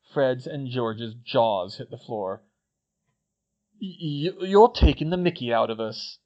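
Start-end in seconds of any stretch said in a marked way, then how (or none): 3.8-6.2 stunned. A narrator's reading. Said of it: "they said in unison".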